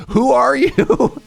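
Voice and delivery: high, loud, slow laughing gasp; who is this stranger, laughing; shocked disbelief. Retold or imitated. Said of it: "Bronx forget about it accent comes out".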